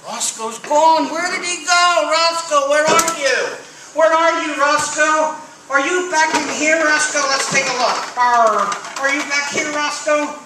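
high, loud and eager; excited. Acted. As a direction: call out to the lost bunny. Searching for the vanished rabbit.

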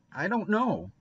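put-on high, hesitant boy's reply; nervous admission. A narrator's reading. Guilty boy cornered by Missus Lynde's questions, nervous.

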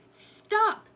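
high, forceful shout; loud storybook cry to stop. A narrator's reading. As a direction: yell it out sharply, pitch leaping up. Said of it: "she shouted again".

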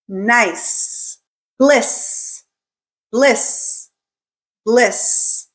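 House, Bus, Nice, Bliss each ending on a long clear S; careful word modelling with pauses between; final-S drill. Shown as a demonstration. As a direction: let the final S hiss out on each isolated word. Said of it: "words where the S is at the end".

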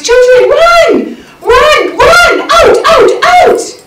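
put-on high, loud panicked yelling at the children; alarmed scream. Mother screaming at her children to flee the skunks, panicked. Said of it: "She immediately screamed at the top of her voice".

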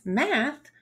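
plain reading voice

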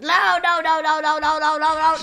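loud rapid panicked nos. Real, not acted. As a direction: cry out no over and over. Ragdoll failing, repeated no.

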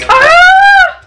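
sudden high shriek; short shriek. Real, not acted. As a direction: yelp in shock. Sees the horrible bean.

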